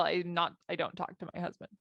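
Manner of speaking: ordinary delivery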